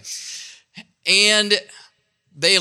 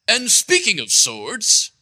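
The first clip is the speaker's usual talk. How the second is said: slow, pointed British delivery; higher-pitched British donkey voice; distinguished British accent; 4 semitones higher, slower than usual. Acted. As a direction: say it slowly in a put-on British accent. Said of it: "in his best distinguished British accent".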